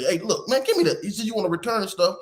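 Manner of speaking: retold fed-up dad giving orders; pissed off. Dad demands the shopping bag from his daughter, pissed. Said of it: "I'm, I'm pissed".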